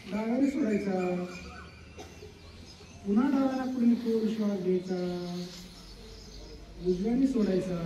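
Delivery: calm yoga teacher voice; soft breathing guide. Acted. Guides alternate nostril breathing.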